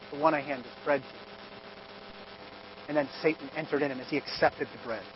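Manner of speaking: very soft, low ominous telling; soft dark hush. A narrator's reading. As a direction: sink to a near-hush. Hushed retelling of betrayal.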